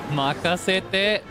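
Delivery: slow, high Japanese catchphrase; excited anime fan. Pretend. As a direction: stretch it out like an anime hero.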